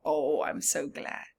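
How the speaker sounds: usual speaking voice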